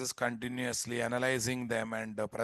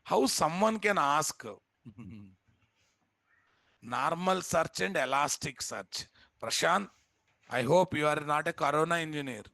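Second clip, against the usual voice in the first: mocking disbelief; 3 semitones higher than usual. Real, not acted. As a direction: ridicule the silly question. Mocking a student's question.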